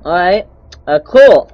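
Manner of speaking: sudden loud startled yelp; startled shout. Real, not acted. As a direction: jump out of your skin. Sudden scare in horror game.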